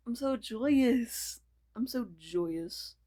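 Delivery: slow, put-on voice; drawn-out joy. Character slowly declares joy in a put-on voice. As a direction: stretch it out in a goofy character voice.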